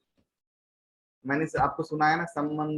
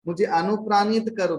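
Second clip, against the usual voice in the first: raised, louder voice of appeal; acted plea; 6 semitones higher, 5 dB louder than usual. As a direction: call out an earnest request to be inspired. Voices a prayer for inspiration.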